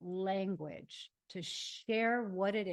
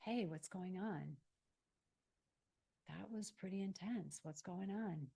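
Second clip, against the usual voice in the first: gentle check-in; 7 dB quieter than usual. Acted. Voices tending to inner child.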